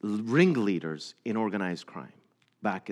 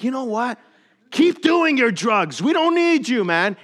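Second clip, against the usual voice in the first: angry shout; 13 semitones higher, 11 dB louder than usual. Retold or imitated. Right-hand man rejecting the converted boss, shouting. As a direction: throw him out with a shout.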